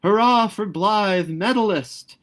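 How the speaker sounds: put-on high, loud, slow cheer from a crowd of boys; drawn-out triumphant yell. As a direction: bellow it like schoolboys carrying a hero. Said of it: "yelling at the tops of their voices".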